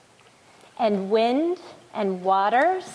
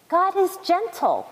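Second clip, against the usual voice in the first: high, lightly voiced storybook robin; lightly chirped; 7 semitones higher than usual. A narrator's reading. Robin answering the roaring lion, lightly chirped. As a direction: lift it into a little bird's chirp. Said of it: "chirped the robin".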